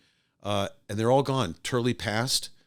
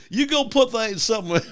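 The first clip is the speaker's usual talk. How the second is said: mock advice; 11 semitones higher, 5 dB louder than usual. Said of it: "if you're gonna be such an okie to say".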